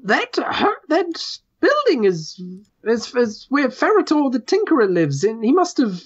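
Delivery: high, halting worried voice; a nervous villager stammering out bad news; fretful little NPC. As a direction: stammer it up high like a frightened small creature.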